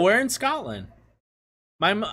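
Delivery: normal talking voice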